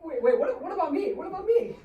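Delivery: high, pleading protest; whiny protest. Acted. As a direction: cut in with a high plea. Character protests, laughing, loud.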